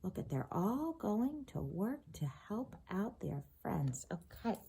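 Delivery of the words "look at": plain reading voice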